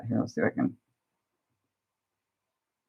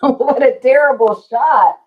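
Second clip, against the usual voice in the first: slow, higher, laughing through the words; laughing at herself; 8 semitones higher, 5 dB louder than usual. Real, not acted. Streamer laughing at her own glary camera shot. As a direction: chuckle at how bad it looks.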